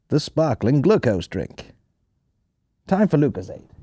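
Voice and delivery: slow, lightly put-on advert voice; old ad voiceover. Old Lucozade advert voiceover, slow and lightly put-on. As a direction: read it like a vintage ad slogan.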